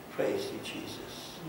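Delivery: breathy hushed whisper of worship; whispered praise. Real, not acted. Pastor murmuring praise to God mid-prayer, whispered. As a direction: breathe the praise almost silently.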